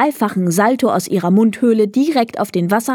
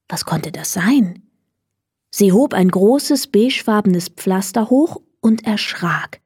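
ordinary delivery, nothing marked

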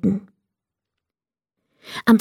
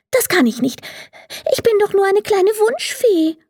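high, small fairy voice; doubtful little fairy; 12 semitones higher than usual. A narrator's reading. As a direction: protest in a tiny, high voice. Fairy doubts she can do it.

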